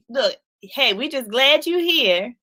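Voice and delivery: ordinary delivery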